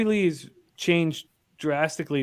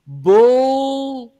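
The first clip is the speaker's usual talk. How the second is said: loud heckle with a long held L; loud drawn-out heckle; 8 semitones higher, 8 dB louder than usual. Retold or imitated. Heckler in the audience calls out to Rogan, loud and drawn out. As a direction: bellow it from the crowd and hang on the L. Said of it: "this loud kind of, and he hanged on to the L".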